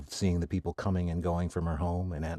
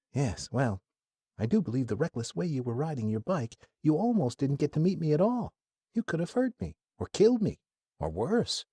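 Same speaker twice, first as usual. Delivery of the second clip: sarcastic; 7 semitones higher than usual. Acted. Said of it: "with a sarcastic tone says".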